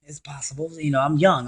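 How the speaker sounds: ordinary talk, nothing marked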